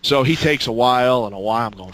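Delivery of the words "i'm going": usual speaking voice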